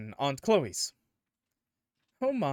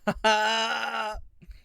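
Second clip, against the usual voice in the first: laughing; 9 semitones higher, 3 dB louder than usual. Real, not acted. Laughs after own joke.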